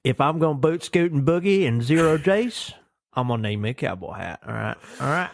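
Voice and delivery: hammed-up Southern cowboy twang. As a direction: state your demands like a cartoon Southern hero. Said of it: "that's how I want all my, like, Southern heroes to talk".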